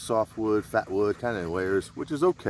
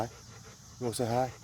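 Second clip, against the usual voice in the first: higher, slow, softer sing-song coo; 5 semitones higher, 6 dB quieter than usual. Real, not acted. Man greets his dog in higher, slow baby talk.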